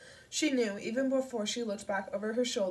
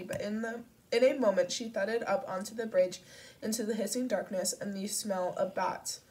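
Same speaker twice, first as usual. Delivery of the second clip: ordinary delivery, nothing marked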